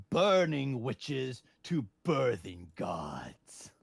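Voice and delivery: very slow, drawn-out ominous delivery; grand dramatic proclamation. Acted. Co-host explains the movie's concept, mock-epic. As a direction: stretch each word out with mock grandeur.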